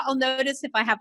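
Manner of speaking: usual speaking voice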